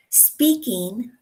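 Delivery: SPEAKING with the final ng fully sounded; careful, complete ending on Speaking; full ING ending. Shown as a demonstration. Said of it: "dropping the ends of words".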